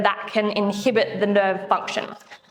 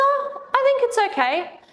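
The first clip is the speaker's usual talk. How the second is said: imitating patient; 14 semitones higher than usual. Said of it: "And he goes".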